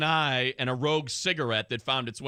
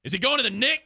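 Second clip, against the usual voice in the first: mock English accent; 9 semitones higher, 3 dB louder, faster than usual. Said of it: "if I try to put on my English accent".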